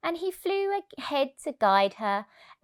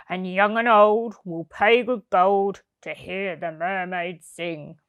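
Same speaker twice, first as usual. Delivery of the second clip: scheming circus owner; 4 semitones lower, 4 dB louder than usual. A narrator's reading. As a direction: lure her in like a sly ringmaster, deep and unhurried. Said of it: "he said".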